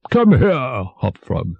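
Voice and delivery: high-pitched kingly summons; commanding king. A narrator's reading. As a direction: call the jester over like a king. King summons his jester.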